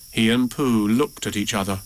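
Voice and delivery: usual speaking voice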